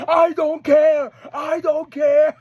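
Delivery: high, slow, stretched-out shout; mock screaming. Imitating wrestler answering Jake Doyle, defiant screaming. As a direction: holler it defiantly, dragging each word out. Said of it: "What are you doing screaming at him?".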